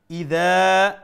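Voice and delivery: ইদা with a long final a; one-alif lengthening. Shown as a demonstration. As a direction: stretch the দা of ইদা. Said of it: "এক আলিফ লম্বা".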